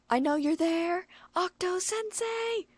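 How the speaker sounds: high, drawn-out yell of the name; shouting. A narrator's reading. The stalker shouting at the door for her author. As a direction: call him out, stretching the name.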